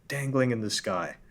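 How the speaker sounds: normal talking voice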